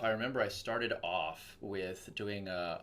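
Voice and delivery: ordinary delivery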